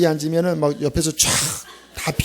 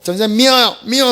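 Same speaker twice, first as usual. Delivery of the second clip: sulky students; 4 semitones higher, 4 dB louder than usual. Retold or imitated. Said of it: "막 이래요".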